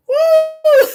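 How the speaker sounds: high, loud "woo"; real giddy squeal of release; giddy whoop. Real, not acted. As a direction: let out a long high whoop. Giddy whoop while feeling a little silly.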